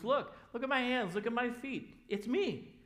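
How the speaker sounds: high eager look at my hands; eager show. Pretend. Jesus showing his wounds to disciples, high and eager. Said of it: "he goes".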